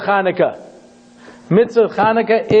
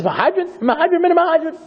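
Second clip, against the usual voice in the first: higher and higher, laughing through it; building up; 10 semitones higher than usual. Shown as a demonstration. Teacher showing good, better, best, pitch climbing. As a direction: climb the ladder with each word. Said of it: "good, better, and best".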